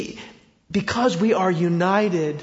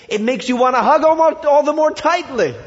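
rising fervor; 8 semitones higher, 6 dB louder than usual. Real, not acted. Preacher's rising climax.

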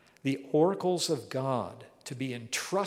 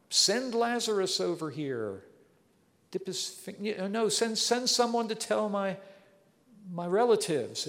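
higher, lightly voiced begging rich man; pleading rich man; 7 semitones higher than usual. Rich man in torment begging Abraham, higher pleading. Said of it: "was asked by the rich man".

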